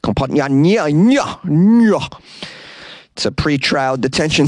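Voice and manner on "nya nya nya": frustrated nya-nya. Real, not acted. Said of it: "I can't say this word".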